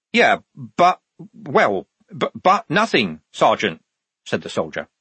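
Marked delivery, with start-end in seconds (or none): 0.1-3.8 high-pitched, stammered retreat; flustered backing down. A narrator's reading. Soldier giving in to an angry sergeant, flustered. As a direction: stumble from protest into giving up.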